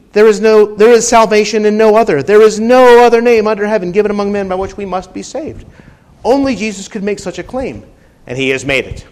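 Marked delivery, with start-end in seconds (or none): 0.1-5.7 loud, fast fervent preaching; preaching at full force. Acted. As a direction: proclaim it hard. Preached scripture quote.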